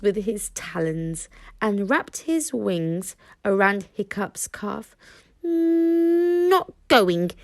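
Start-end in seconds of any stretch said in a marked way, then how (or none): 5.4-7.3 high, loud little dragon refusing; stubborn dragon. A narrator's reading. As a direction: dig in, a stubborn small dragon. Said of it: "said Toothless stubbornly".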